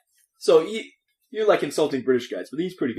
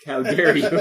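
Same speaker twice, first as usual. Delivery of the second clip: loud mock indignation; acted outrage, louder; offended; 3 semitones higher, 6 dB louder, slower than usual. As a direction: snap it out as a sudden affront. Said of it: "His, uh, features darken a bit".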